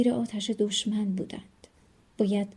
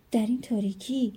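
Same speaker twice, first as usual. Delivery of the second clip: ordinary delivery, nothing marked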